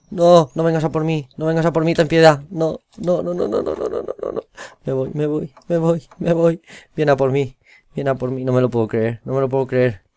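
high, loud panic while being chased; panicked pleading. Real, not acted. Streamer fleeing the beast in game, panicked high pleading. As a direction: beg for mercy in real fright.

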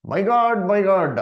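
a mock anguished shout, high and loud; loud cry. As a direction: call out to God from the cross. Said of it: "with a loud voice".

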